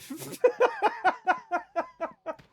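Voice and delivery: shrieking high-pitched laugh; real high cackle of laughter. Real, not acted. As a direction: burst out in a squealing laugh. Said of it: "It wasn't actually my nickname".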